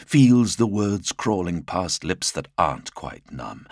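ordinary delivery

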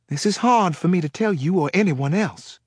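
a frightened woman with a Southern lilt; Southern accent. Acted. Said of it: "with a heavy Southern accent".

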